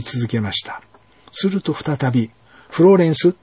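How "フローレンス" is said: louder, higher call of a name. A narrator's reading. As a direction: summon her with a clear call. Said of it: "呼びかける声がしました". (高く強く呼びかける声)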